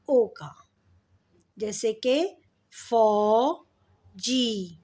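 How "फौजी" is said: फौजी split into fau and jee, very slow; drawn-out syllables. Shown as a demonstration. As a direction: stretch both vowels of फौजी. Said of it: "ओ का sound आ रहा है".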